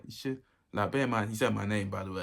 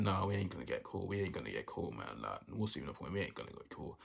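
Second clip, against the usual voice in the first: thinking to himself; 3 semitones lower, 6 dB quieter than usual. Retold or imitated. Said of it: "we just there thinking".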